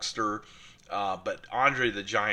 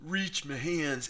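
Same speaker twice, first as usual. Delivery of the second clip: half-hearted stab at a movie villain's voice, higher and slow; attempted Christopher Walken; 6 semitones higher than usual. Vlogger attempting a villain voice for viewers, higher, slow, half-hearted. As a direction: try the actor's voice, give up halfway. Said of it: "In, I can't do his voice".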